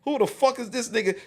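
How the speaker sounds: high-pitched imitation of disbelief; baffled indignation. Floyd looks at the group, voiced in high indignant disbelief.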